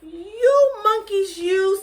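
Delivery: high, loud, slow scolding of monkeys; peddler scolding. Acted. Peddler scolds the monkeys from below, loud and high. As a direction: wag a finger and scold them shrilly. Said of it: "shaking his finger at them".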